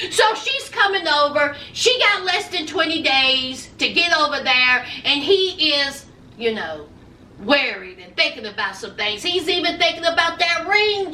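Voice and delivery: ordinary talk, nothing marked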